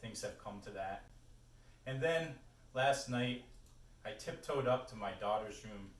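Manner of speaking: projected recital in short phrases with weighted pauses; poetry reading. Acted. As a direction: start each line lifted, let it fall away. Reads a poem aloud.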